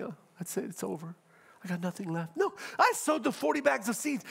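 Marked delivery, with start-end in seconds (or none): 0.0-2.4 soft, defeated farmer's lament; half-hearted acted weeping; mock crying. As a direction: pretend to be tearful, voice dropped in level. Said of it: "Oh, I'm crying".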